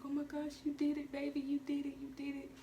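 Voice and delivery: high-pitched proud mother cheering; mimicking her mom cheering after the birth; imitating excited mom.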